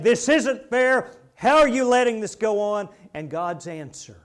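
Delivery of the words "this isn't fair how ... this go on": loud, raised challenge; Habakkuk. Retold or imitated. Prophet challenging God over injustice, in loud protest. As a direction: protest forcefully at a higher pitch. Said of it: "Habakkuk challenging God and saying".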